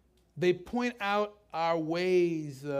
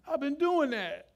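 slow, higher whine; mock whiny retort; 9 semitones higher than usual. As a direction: complain like a spoiled adult. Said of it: "whiny might be".

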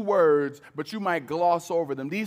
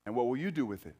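softer, lower, reflective repeat; 3 semitones lower, 5 dB quieter, much faster than usual. Real, not acted. Pastor repeats the question to the congregation, softly. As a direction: let the question sink in quietly.

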